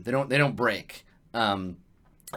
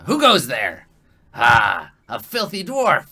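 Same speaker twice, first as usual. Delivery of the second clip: big goblin challenge; 8 dB louder, slower than usual. Acted. Big goblin confronts a dwarf intruder, loud scornful challenge. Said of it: "he's like, says in Goblish".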